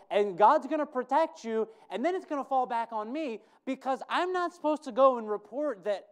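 loud, high worried pleading; acted anxious protest. Preacher voices Obadiah's protest.

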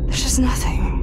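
"just" leaned on, the line whispered and falling; whispered despair. Acted. Scout sinking into a whisper as she admits it to herself. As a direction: repeat it again under your breath, defeated.

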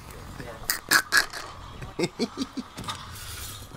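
breathy laughter. Real, not acted. Long laugh at player leaving map.

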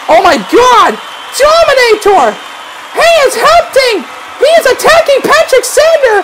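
high, sustained excited play-by-play; thrilled shouting. Real, not acted. Commentator seeing Dominator arrive to help, thrilled shouting. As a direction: erupt at the surprise arrival. Surprise rescue mid-match.

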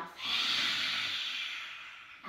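long loud open-mouth exhale; loud breathy lion's breath exhale. Shown as a demonstration. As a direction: push the breath out loudly, tongue out. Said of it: "Exhale out through the mouth".

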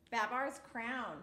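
ordinary delivery, nothing marked

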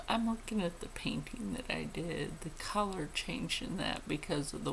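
soft whisper about the painting; whispered aside. Real, not acted. Admiring her own painting, whispered. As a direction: murmur it to yourself.

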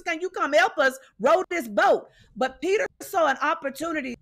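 normal talking voice

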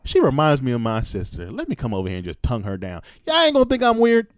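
ordinary talk

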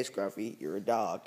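ordinary delivery

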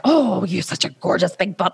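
lightly cooing, a touch put-on; fond mock admiration; mother praising her daughter's glutes; playful praise. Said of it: "And I'm like".

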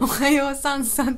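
goofy morning wordplay on the edge of laughter; playful, jokey hello; joking greeting. Shown as a demonstration. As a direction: toss out a silly greeting. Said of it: "茶化して言う".

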